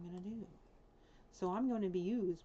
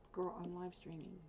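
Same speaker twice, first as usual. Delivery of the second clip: ordinary delivery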